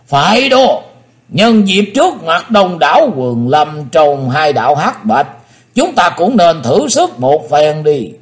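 high, loud menacing elder; villain voice. A narrator's reading. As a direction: sneer it out as an old demon. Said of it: "trầm mặt xuống nói".

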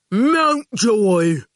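slow, loud, pleased henchman voice; proud goon. A narrator's reading. The goon proudly presenting Mountjoy to Howard, slow and loud. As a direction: present him like a prize.

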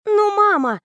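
high, indignant protest to mum; indignant girl. Acted. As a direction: object like a miffed teen. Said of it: "возмутилась Аня".

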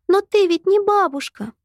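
put-on high, cross child's protest; a small girl snapping back; angry little girl. A narrator's reading. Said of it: "Юйшаго рассердилась".

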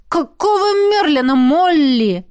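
Jet howls it out, loud and high; a loud, outraged wail; howling outburst. Acted. Jet outraged at Molly's teasing, loud howl. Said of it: "взвыл он".

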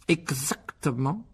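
slow, drawn-out menacing approval; nasty. A narrator's reading. Uncle confirming the boy must stay hidden, nasty tone. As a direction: draw the word out with malice. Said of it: "d'un ton mauvais".

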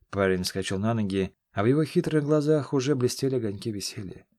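ordinary delivery

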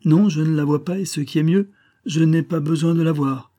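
high, loud tipsy defiance; drunken exclaiming. A narrator's reading. Young man scorning Lady Lisle, loud drunken exclaiming. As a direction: cry out with drunken bluster. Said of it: "s'écria le jeune homme".

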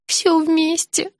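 slow, high, pitiful little voice; plaintive squeak. A narrator's reading. Patient answering the dentist, plaintive squeak. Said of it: "жалобно пропищала я".

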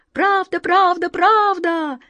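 very high, eager little wind voice; a playful whirlwind shouting down; wind sprite voice. Acted. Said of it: "послышалось откуда-то сверху".

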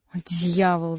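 usual speaking voice

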